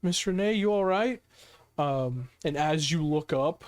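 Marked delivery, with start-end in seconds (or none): 0.0-1.2 higher, lightly voiced worried question; Penny. Penny kneels by Miss Renee, higher concerned voice. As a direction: gently check on someone, pitched up. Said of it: "Penny ... just goes".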